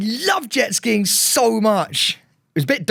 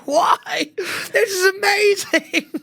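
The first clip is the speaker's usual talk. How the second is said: high, slow, laughing through the words; laughing gleeful reply; 13 semitones higher, much slower than usual. Retold or imitated. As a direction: giggle it out with delight. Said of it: "I go".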